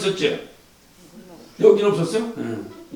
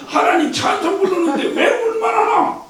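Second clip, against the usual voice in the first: exasperated question; 11 semitones higher than usual. Real, not acted.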